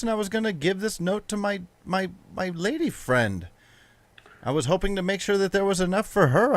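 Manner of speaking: high, pleading servant; nervous servant voice. Acted. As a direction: talk him down, anxious and high.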